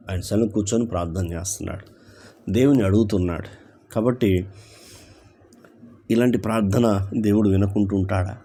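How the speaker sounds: ordinary talk, nothing marked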